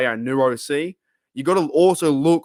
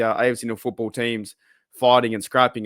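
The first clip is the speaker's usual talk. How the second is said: normal talking voice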